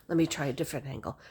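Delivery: fast whisper to herself; whispered mock fuss. To herself while retaking a selfie, a fast whisper. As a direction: mutter it under your breath, fast. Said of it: "you're gonna be like".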